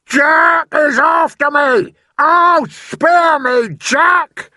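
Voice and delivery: very high, loud, slow wailing plea; panicked bellow. A narrator's reading. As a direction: beg for mercy at full scream. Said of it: "bellowed the flesh lump eater".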